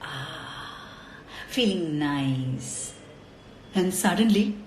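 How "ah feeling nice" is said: slow, soft contented sigh; lazy relaxed murmur; chilled out sigh. Acted. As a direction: melt into the cool air, slowly. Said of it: "He was really chilled out".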